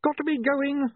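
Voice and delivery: very high, frantic yelp; strained high-pitched scream. A narrator's reading. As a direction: cry out in a panic, pitch leaping. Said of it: "William screamed".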